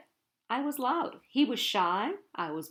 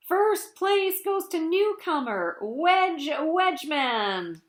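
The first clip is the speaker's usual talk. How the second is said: higher, louder, slow announcement; announcer voice; 5 semitones higher, 4 dB louder, slower than usual. Retold or imitated. Announcer declaring the winner, booming and slow. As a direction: boom it out like a stadium announcer. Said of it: "a thunderous voice making the dreaded announcement".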